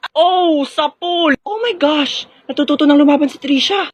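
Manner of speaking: high, excited gasping exclamation; shocked delight. Acted. Classmate watches the bully get hit, high thrilled reaction. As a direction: react high-pitched to a landed blow.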